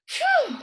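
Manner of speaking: high, loud sigh of relief; relieved phew. A narrator's reading. Dog relieved after the beast's rescue. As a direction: blow out relief. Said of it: "said the dog".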